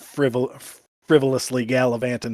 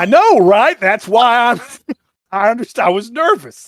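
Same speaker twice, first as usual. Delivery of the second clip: flustered defence; 9 semitones higher, 9 dB louder than usual. Real, not acted. Embarrassed laughing self-defence.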